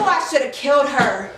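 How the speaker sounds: plain reading voice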